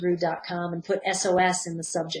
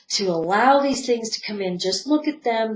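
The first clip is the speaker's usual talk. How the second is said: usual speaking voice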